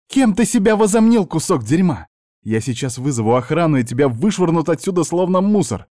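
high-pitched, indignant tirade; furious threat. A narrator's reading. Blonde advances on her uncle threateningly, furious. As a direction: open high and loud, dip, then climb again.

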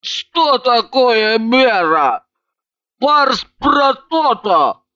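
slow, stretched tipsy astonishment; surprised drunk. A narrator's reading. Drunk friend baffled by talk of moderation, surprised. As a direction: ask in drawn-out, puzzled amazement. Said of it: "Иванова искренне удивилась".

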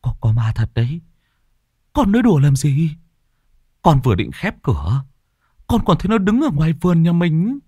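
insistent frightened arguing. A narrator's reading. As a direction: argue hard that the ghost is real. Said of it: "cố cãi".

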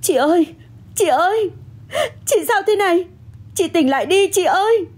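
high-pitched, frantic pleading; panicked calling. Acted. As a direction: call out in rising panic to someone fainting. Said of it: "Tôi cuống lên gọi".

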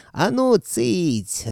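high, long-drawn order to hush; sudden commanding bellow. A narrator's reading. Sorcerer Lihovit silencing the chattering captives, bellowed command. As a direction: pitch it up and stretch the command. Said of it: "рявкнул вдруг Лиховит".

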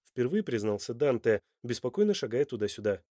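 usual speaking voice

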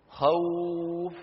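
خوف said very slowly, the و gliding long; drawn-out soft vowel. Shown as a demonstration. Teacher demonstrates the easy glide to students, slow. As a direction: let the sound in خوف flow out slowly. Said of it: "جربوا هكذا".

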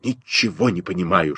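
low, hushed aside to a friend; whispering. A narrator's reading. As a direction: murmur it softly, puzzled. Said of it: "шепнул мне Костя". (тихим шёпотом, приглушённо)

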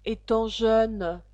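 loud, measured poem declamation; verse recitation. Acted. Alice reciting to the caterpillar, loud verse declamation. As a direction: declaim the verse, drawing out the phrases.

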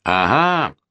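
plain reading voice

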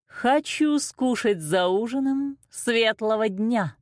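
high, slow, hungry queen; wicked ogress queen. A narrator's reading. Wicked queen orders the cook to serve a child, greedy ogress.

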